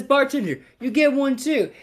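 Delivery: high, grand call to the bartender; generous announcement. Acted. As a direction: lift the voice high, playing magnanimous. Joke's man generously includes bartender.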